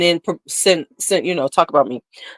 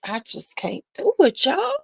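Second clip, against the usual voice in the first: ordinary delivery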